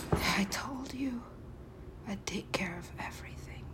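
hushed secret whisper. Acted. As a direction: breathe it out as a secret. Whispered game line.

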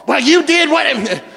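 slow, mock-accusing "you did what"; mock accusing spouse. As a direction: play the wronged spouse, hammed-up. Angry spouse acted.

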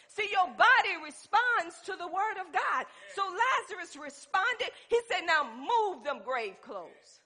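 loud, high-pitched pulpit delivery; full-force preaching. Real, not acted. Preacher telling people their lifestyle must match belief, forceful preaching.

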